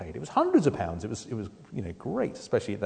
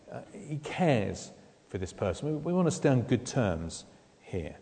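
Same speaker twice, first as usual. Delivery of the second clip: ordinary delivery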